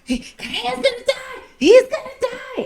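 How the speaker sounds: very high, panicky mock scream; panicked scream. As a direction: shriek that he's dying. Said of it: "he's screaming".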